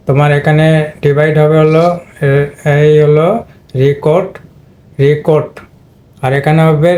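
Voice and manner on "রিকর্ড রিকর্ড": রিকর্ড as ree-KORD, open first syllable; long ee first syllable. Shown as a demonstration. Teacher dividing the syllables for students, ree-kord for রিকর্ড. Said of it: "long ই sound create হয়েছে".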